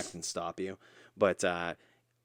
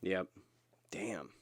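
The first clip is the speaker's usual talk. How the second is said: whispered; 7 dB quieter than usual. Real, not acted. Sympathetic reaction to lost match.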